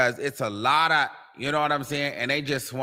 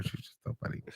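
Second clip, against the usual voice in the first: breathless whisper; 15 dB quieter, much faster than usual. Real, not acted. Fading whisper.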